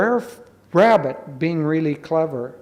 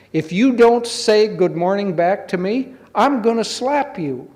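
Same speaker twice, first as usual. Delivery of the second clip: higher, storybook scolding; indignant threat; 5 semitones higher, 4 dB louder than usual. Acted. Brer Rabbit warns the silent doll, high and indignant. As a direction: threaten like an offended character.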